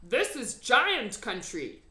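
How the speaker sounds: the giant showing Sophie the land; solemn giant. A narrator's reading. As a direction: announce it slowly as the giant. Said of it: "the B F G said".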